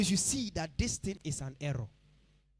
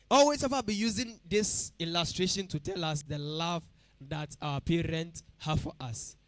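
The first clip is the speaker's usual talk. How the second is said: ordinary talk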